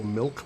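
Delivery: "milk" with the vowel opened to "melk"; Midwestern "milk". Shown as a demonstration. Streamer showing Midwest speech, "milk" as "melk". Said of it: "people call milk milk".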